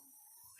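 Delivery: breathy unvoiced mock moan; porno moan. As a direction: imitate a tiny porn-style moan. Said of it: "she did, like, the porno thing".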